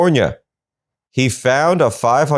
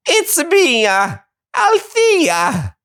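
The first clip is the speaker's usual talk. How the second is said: very high cartoon Italian, drawn out slowly; Mario voice; much slower than usual. Host mocking Althea's new accent, as a cartoon Mario. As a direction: do a hammed-up Super Mario. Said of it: "Does she think she's Super Mario?".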